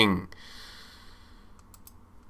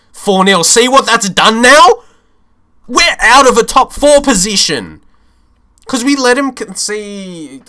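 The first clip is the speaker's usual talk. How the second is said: agitated outburst; 7 semitones higher, 4 dB louder than usual. Real, not acted. Reacting to the table changing.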